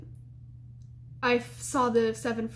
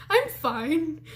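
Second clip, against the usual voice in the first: higher, strained imitation of claiming to be fine; mock-brave, pitched up; shaken denial; 5 semitones higher than usual. Shaken student reassuring her roommate, high unconvincing denial.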